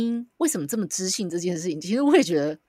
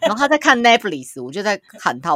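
ordinary talk, nothing marked

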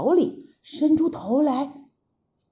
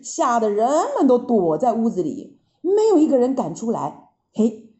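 plain reading voice

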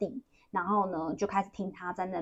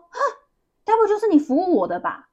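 high, loud gasp of recognition, acted out; startled re-enacted exclamation, much higher and louder; shocked realization; 12 semitones higher, 9 dB louder than usual. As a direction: imitate someone suddenly realizing, voice leaping up. Retells client's shocked moment of recognition.

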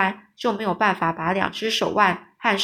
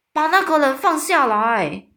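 raised, higher-pitched command; friendly giant shouting; 5 semitones higher, 4 dB louder than usual. A narrator's reading. Friendly giant ordering the captor to release him, shouting. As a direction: call it out as an urgent order. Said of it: "这友善大巨人的大叫说".

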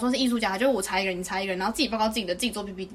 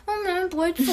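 mocking the classmate; 8 semitones higher than usual. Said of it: "然后到报告当天说".

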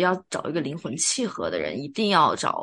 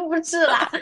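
high bursts of embarrassed laughter; laughing; 11 semitones higher than usual. Real, not acted. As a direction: laugh it off, protesting through giggles. Laughing off a teasing joke.

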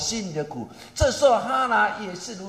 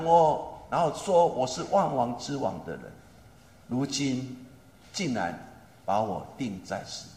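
hushed sorrowful preaching; 8 semitones lower than usual. Acted. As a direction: let it sink low and sad, drawing them into Jesus' pain.

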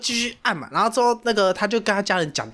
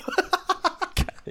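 high, softer laughing burst; laughter; 12 semitones higher, 4 dB quieter than usual. Real, not acted. Teller laughing at the message he sent his girlfriend. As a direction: laugh at your own text.

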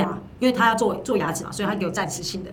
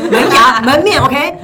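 loud, high emphasis on the front teeth; telling friends the front teeth matter, loud emphatic stress; emphatic loud exclaim; 5 semitones higher, 12 dB louder, much slower than usual. Real, not acted. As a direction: punch each word to drive it home. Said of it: "stressing the front teeth".

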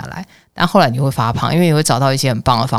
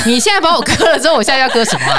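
laughing; 11 semitones higher, faster than usual. Real, not acted. Joking laughter about tongue-cutting.

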